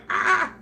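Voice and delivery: very high sudden mock scream; imitating Wendy's scream. Imitating Wendy joining the anime yell, high mock scream. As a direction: squeal it like a friend jumping in. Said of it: "but she's like".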